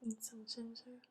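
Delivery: hushed whisper. Real, not acted. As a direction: whisper it softly. Whispering while eating.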